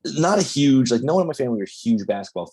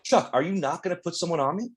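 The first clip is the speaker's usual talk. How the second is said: mock innocent Bird; 7 semitones higher than usual. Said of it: "He's like".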